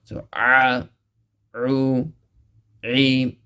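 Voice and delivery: "a, u, e" with a squeezed throat catch; throat-squeezed ain. Shown as a demonstration. Teacher drilling ain from the middle of the throat. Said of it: "you have to stress that sound".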